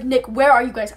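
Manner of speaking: loud re-enacted panicked call; panicked plea. Repeating the frantic phone plea to Nick, panicked and loud. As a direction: demand urgently where your friends are. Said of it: "I'm scared out of my mind".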